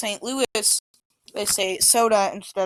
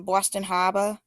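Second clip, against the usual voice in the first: Boston Harbor with the r sounds dropped, Hahbah; a light Boston accent, no r. Shown as a demonstration. Teacher showing how Bostonians say it, Boston accent. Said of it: "they talk like that".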